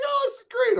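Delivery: very high falsetto imitation; mock-cheerful squeal; gushing family. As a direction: mimic a delighted relative way up high. Imitates his family at the draft.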